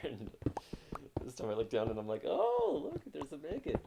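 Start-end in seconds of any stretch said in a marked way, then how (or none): 2.3-3.8 jumps way up in pitch, loud and squealy; a big happy gasp of recognition; delighted surprise. Real, not acted. Streamer spotting Megan in her chat, squealing with delight.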